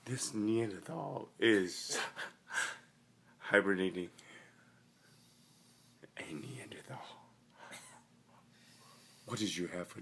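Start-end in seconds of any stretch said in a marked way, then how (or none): 0.0-4.1 slow dramatic nature narration; documentary narrator. Acted. 6.2-7.0 hushed wildlife whisper; whispering narrator. Acted. Whispered documentary aside.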